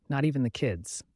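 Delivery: ordinary delivery, nothing marked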